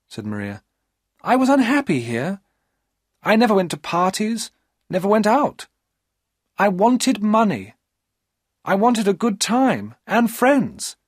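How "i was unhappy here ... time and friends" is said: put-on young woman pleading her case; high, wistful female voice; Maria's complaining voice.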